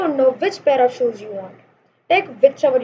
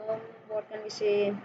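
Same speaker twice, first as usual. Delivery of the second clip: very soft, lower-pitched musing; thinking aloud; 4 semitones lower, 14 dB quieter than usual. Real, not acted. Teacher stuck for an example sentence, musing under the breath. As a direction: say it under your breath, searching.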